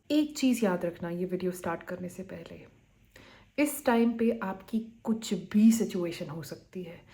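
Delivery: ordinary talk, nothing marked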